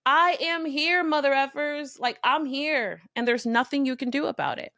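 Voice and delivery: "I am here" flung out high and bold, then slowing; proud, defiant, daring anyone to stop you; defiant declaration. Shown as a demonstration. Coach to listeners, modelling a defiant self-declaration. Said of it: "I really want you to say this to yourself right now".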